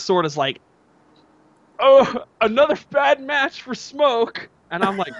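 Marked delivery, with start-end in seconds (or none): 1.8-4.5 high, drawn-out mock whine; mock whining. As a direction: imitate a sore loser moaning, slow and high. Mimicking a sore loser's complaint.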